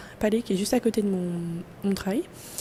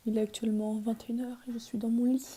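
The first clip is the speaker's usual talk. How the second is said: soft unvoiced bedtime whisper; 8 dB quieter than usual. Real, not acted. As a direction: whisper so you don't wake anyone. In bed at night.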